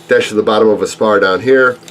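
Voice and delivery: ordinary talk, nothing marked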